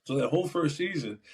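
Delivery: normal talking voice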